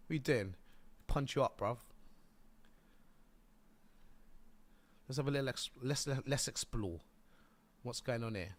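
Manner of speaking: roadman streamer voice; lively London slang voice; London street accent. Acted. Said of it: "you put on a weird voice".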